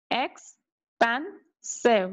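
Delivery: Expensive said very slowly, each syllable apart; slow syllable demo. Shown as a demonstration. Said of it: "So three syllables are there".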